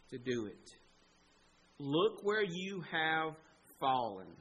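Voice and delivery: slow, deliberate phrases with pauses, falling low; grave slow questioning. Acted. God confronting fallen Adam, grave and slow. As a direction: weigh each phrase, drop on the last word.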